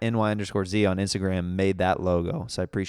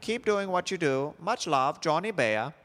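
reading a fan letter in a higher mock Norwegian accent; host reads a Norwegian fan's letter aloud in a higher mock Norwegian accent; 5 semitones higher than usual. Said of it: "my attempt at a Norwegian accent".

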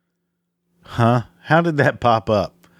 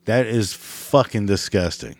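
ordinary delivery

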